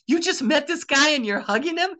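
high-pitched incredulous question; astonished onlooker. Retold or imitated. Woman questioning the hug, astonished.

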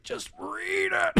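high, drawn-out desperate yell; Brendan Fraser. Acted. Charlie begging the missionary to read the essay, high drawn-out plea. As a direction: stretch it out in a pleading shout. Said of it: "That's actually a pretty good Brendan".

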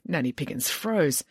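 plain reading voice

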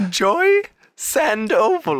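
Joy Sandoval drawn out slowly and high; spelling the name out in a high lady voice; slow careful name. Shown as a demonstration. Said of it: "repeats the name".

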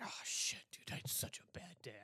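hushed, whispered lament; imagined principal. Pretend. Principal after a bad day, whispered lament. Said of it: "like, a principal had a bad day".

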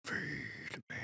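eerie drawn-out whisper; very soft sinister whisper; sword's voice. Acted. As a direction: whisper it slowly like a hungry cursed sword. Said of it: "You just hear that".

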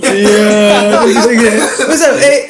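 mocking impression. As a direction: overdo the friend's hello to tease. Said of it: "I do not sound like that".

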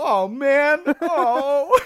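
high, loud, strained moan after a knock; pained groan. Retold or imitated. After hitting his head on the wall, high loud pained moan. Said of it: "I go".

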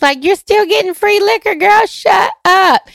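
high, loud, quick sassy comeback; mock scolding retort. Imagined listener telling the host to stop complaining, mock scolding.

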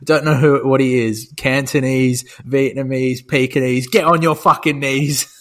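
lightly voiced film gangster, loud; quoting the film. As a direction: bark it like the villain. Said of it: "He goes".

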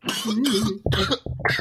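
harsh choking cough. Real, not acted. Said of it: "I'm choking".

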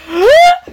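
high, loud gasp; shocked gasp. Real, not acted. Gasp at the kiss.

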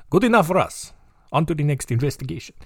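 easily satisfied Russian cop, brisk wrap-up; comic Russian-accented dismissal; Russian police accent. Acted. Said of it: "about Russian police is they are pretty surface level".

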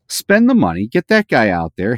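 usual speaking voice

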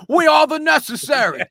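high, loud rock-star announcement; Brit pop frontman. Pretend. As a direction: greet the crowd as a Britpop singer. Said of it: "the name of my Brit pop band".

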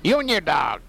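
lightly voiced high witch; a hint of the witch; Wicked Witch. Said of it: "sounds kinda like the Wicked Witch".

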